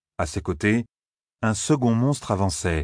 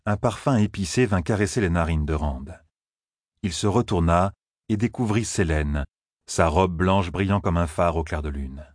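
plain reading voice

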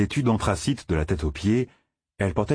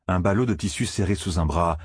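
usual speaking voice

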